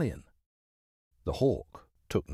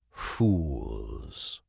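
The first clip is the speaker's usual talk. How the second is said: "fools" dropped very low; contemptuous low fools; 11 semitones lower than usual. Acted. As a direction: growl "fools" in scorn. Hawk contempt.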